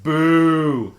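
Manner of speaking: loud jeering boo; crowd booing. Retold or imitated. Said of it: "Everyone else went".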